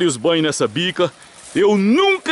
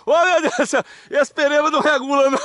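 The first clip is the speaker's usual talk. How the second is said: laughing; 7 semitones higher than usual. Real, not acted. Laughing at friend's antics.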